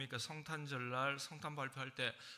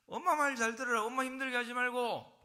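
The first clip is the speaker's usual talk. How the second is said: much higher, much louder scolding; scolding parent; 11 semitones higher, 10 dB louder than usual. Retold or imitated. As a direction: yell at the kids to obey mom. (아이들에게 엄마 말 들으라며 크게 소리치는 부모)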